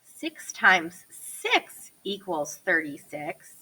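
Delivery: slow, word-by-word dictation; slow it down so they can write it; dictating. Shown as a demonstration.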